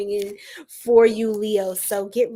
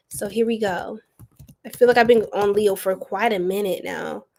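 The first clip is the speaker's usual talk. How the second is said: ordinary delivery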